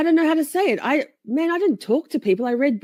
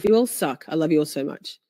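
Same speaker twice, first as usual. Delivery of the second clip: ordinary talk, nothing marked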